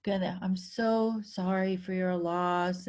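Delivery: heavy condolence. Retold or imitated. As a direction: imitate a visitor bringing the mood down. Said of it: "like the energy just goes".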